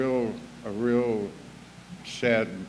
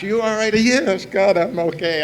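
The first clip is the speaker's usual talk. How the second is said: high, loud asking and snapping back; every few blocks, fussing then fed up; exasperated back-and-forth; 10 semitones higher, 10 dB louder than usual. Retold or imitated. Friend keeps asking and he answers, high and loud.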